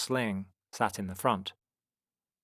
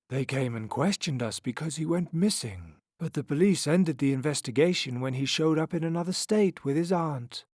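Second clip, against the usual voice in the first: higher-pitched, lightly voiced clown; clown explaining; 7 semitones higher, 3 dB louder than usual. A narrator's reading. Clown explains the police visit, in raised pitch.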